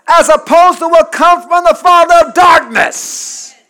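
loud, high-pitched pulpit delivery; impassioned sermon at full volume; full-force preaching. Real, not acted. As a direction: preach it hard, driving each phrase home.

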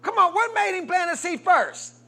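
loud high preacher's challenge; pressing call-out. Real, not acted. Preacher shouting the question at the congregation. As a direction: push them for the answer.

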